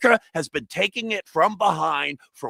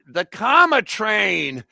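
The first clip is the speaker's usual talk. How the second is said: drawn-out, mock Boston accent; terrible Boston accent; 3 semitones higher, slower than usual. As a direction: say it in a badly faked Boston accent. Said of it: "with a terrible Boston accent".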